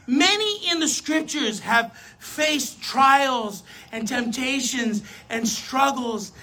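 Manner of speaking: ordinary talk, nothing marked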